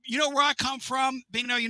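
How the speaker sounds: loud disbelieving outburst; exasperated shout. Real, not acted. Reacting to a mom parading.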